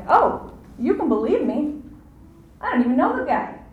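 high, swooping protest of innocence; mock denial. Preacher mimics Peter disowning the guy, in mock protest. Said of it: "So first he feigns ignorance, and then he's like".

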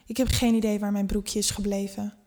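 ordinary delivery, nothing marked

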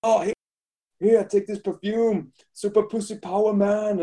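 lightly voiced attendant selling perfume; toilet attendant.

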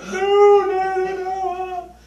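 high, loud pleading no's; squeaky child begging the dog to stop; little girl's frantic no. Retold or imitated. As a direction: beg like a panicked little girl. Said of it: "the girl's like".

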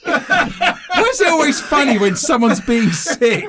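high laughing through words. Real, not acted. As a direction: talk while laughing hard. Laughing at someone vomiting.

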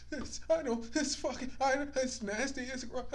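high-pitched mock whimpering kid; imitating tearful son. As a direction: whine it in pieces, like a crying child. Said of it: "the tears just started fucking flowing".

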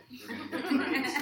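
giggly falsetto mock reassurance; mock comforting friend. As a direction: tease him like a patronising mate while cracking up. Friends mock-comforting him, laughing.

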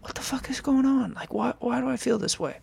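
high, bewildered cry of confusion; what is happening to me, high and rattled; panicked disbelief. Retold or imitated. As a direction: voice jumps up in frightened disbelief. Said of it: "I'm like".

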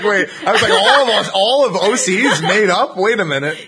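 high, loud incredulous "wait"; surprised disbelief. Retold or imitated. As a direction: act out sudden shocked realisation.

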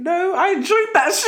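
high, laughing, delighted; gleeful inner voice. Retold or imitated. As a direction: let the secret joy burst out. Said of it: "inside I'm thinking".

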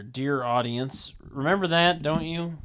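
ordinary delivery

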